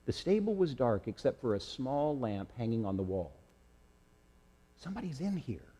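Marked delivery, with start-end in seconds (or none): 4.8-5.7 hushed, high and fearful; whispered. Acted. Lamb warns his friend of a stranger, frightened whisper. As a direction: breathe it out, afraid of being heard. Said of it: "Josh whispered".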